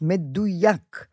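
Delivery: מדויק drawn out very slowly, each syllable weighed; stress on מדויק. Shown as a demonstration. Word drawn out as closing. (מדויק נאמר לאט ובהדגשה)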